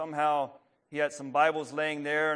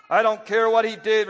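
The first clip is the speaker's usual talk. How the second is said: higher, louder angry retort; 7 semitones higher, 10 dB louder than usual. A narrator's reading. Burmaster rejecting the thief catcher's plea, angry louder retort. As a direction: snap back at the thief catcher. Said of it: "growing angry".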